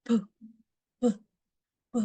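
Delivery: P, P, P with a burst of air; P, P, P, lips released hard; explosive P sound. Shown as a demonstration. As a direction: pop the lips on each P. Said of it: "there is an explosion".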